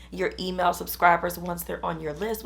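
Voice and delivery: ordinary talk, nothing marked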